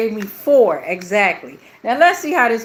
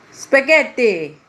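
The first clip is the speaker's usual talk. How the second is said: drawn-out, louder Italian accent; strong Italian accent; 3 semitones higher, 4 dB louder, slower than usual. Shown as a demonstration. Player shows off a pasta shape, strong Italian accent. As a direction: stretch the word out in a put-on Italian accent. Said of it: "That's my strong Italian accent".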